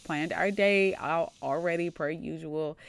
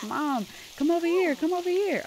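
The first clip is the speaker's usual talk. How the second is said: high, louder pretend kid call; child calling out; 10 semitones higher, 5 dB louder than usual. Child calls mom over to the well, insistent.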